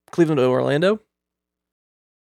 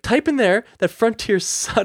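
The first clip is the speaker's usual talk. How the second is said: higher, slow dictation; mock defiance; 7 semitones higher, slower than usual. Customer dictating a complaint to agent, mock defiant.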